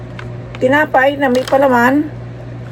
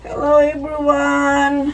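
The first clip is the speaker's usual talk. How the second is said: drawn-out greeting; 5 semitones higher, much slower than usual. Acted. Vlog greeting, slow.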